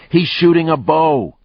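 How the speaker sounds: high, loud shout; shouted warning. Acted. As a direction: call it out to the team. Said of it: "Lucas shouted at the others".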